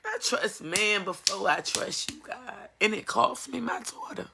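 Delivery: hushed real confession to God; quiet confession. Real, not acted. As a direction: let it sink to a hushed admission.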